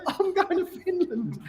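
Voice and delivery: high, slow laughing announcement. Retold or imitated. Son telling his parents his plan, laughing slowly. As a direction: declare it through laughter. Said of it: "I goes".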